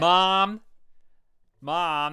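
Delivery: loud call across the house. Acted. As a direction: yell for mom to come. Calling for mother.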